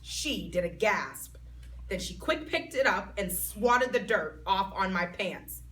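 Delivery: ordinary delivery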